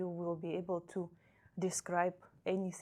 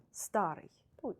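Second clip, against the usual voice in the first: ordinary talk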